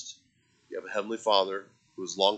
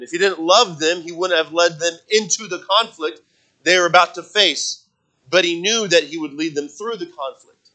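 loud, high, fervent preaching; 8 semitones higher, 11 dB louder than usual. Acted. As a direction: preach it out with full force to the congregation. Preaching at full force.

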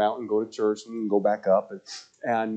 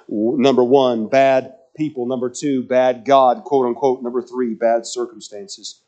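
louder, punchy list of points; loud preaching; 2 semitones higher, 9 dB louder than usual. Acted. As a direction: preach each point hard.

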